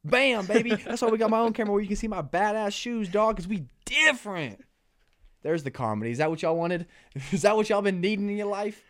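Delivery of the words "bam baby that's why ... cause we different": loud, high, fast hype to the viewers; hype-man show-off. Pretend. Podcast host hyping his cameras to viewers, loud and excited. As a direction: pump up the crowd like a showman.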